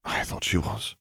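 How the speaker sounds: soft, hushed reply; murmured. A narrator's reading. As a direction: murmur it under your breath. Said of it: "Fleur murmured".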